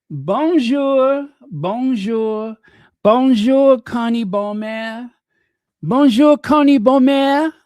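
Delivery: high, loud French-accented greeting. Shown as a demonstration. Host demonstrating a greeting to Connie, French accent. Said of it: "say it with that French accent".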